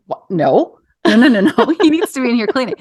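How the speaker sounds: high, fast protesting no no no; indignant refusal. Retold or imitated. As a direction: brush it off, half laughing and indignant. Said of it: "And I was like".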